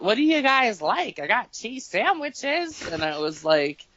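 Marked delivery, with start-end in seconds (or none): 0.0-2.8 high, slowing, sweet grandma offer. Retold or imitated. Grandma offers grandkids cheese sandwiches, high sweet voice. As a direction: offer lunch like a doting grandma. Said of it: "she's like".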